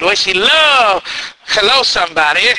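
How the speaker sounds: ordinary talk, nothing marked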